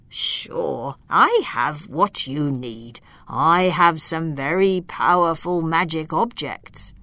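calm reassuring magician; wise old wizard. Acted.

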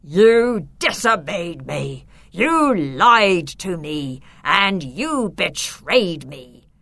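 slow, loud, thundering accusations; furious. A narrator's reading. The Count rebukes his disobedient nephew, furiously. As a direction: hammer out each phrase slowly in rage. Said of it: "But the Count was furious".

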